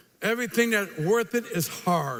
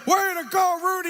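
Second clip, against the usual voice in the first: cheering president; 8 semitones higher than usual. Retold or imitated. President cheering after the movie.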